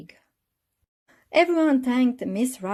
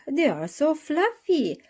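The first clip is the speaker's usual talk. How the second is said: cried out; 9 semitones higher, 5 dB louder, slower than usual. A narrator's reading. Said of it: "cried the children".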